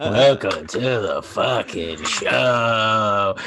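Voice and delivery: put-on slow, slurred drunk welcome; like a drunk guy about to get his ass kicked. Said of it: "You sound like a drunk guy".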